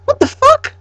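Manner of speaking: high, shrill disbelief squeaked out; shocked squeal. Real, not acted. Reacting to the frozen girlfriend, she squeals in high shock. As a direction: yelp it in total disbelief.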